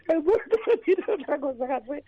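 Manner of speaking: real laughter breaking into the line; laughing. Real, not acted. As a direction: squeeze the words out while cracking up. Laughing at own story.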